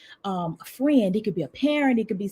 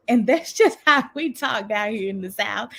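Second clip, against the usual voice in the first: ordinary delivery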